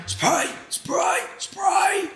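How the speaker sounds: high, breathless, strained plea for the spray; desperate gasp. Retold or imitated. To the paramedics during his heart attack, high breathless gasping. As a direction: gasp the words out like you can't breathe.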